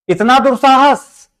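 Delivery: high, drawn-out mock outrage; indignant exclamation. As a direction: act affronted, raising pitch and stretching it out. Teacher acts out offended reaction.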